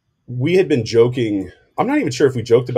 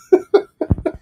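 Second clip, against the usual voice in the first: breathy laugh; 5 dB louder than usual. Real, not acted. Still laughing at the joke.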